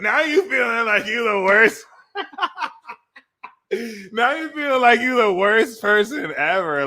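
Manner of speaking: amused, laughing through the words. Real, not acted. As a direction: crack up while saying it. Laughing at Pisces guilt trip.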